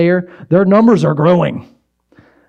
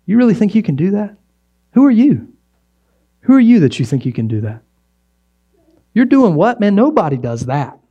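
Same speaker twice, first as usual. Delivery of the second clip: mocking doubters; 4 semitones higher than usual. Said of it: "people around me that'll say".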